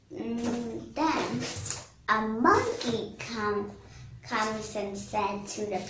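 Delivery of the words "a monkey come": ordinary delivery, nothing marked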